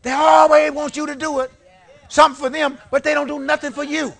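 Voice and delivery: full-voiced preacher's outburst; preaching shout. Real, not acted. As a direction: call it out to the whole church. Repeats the line at full force.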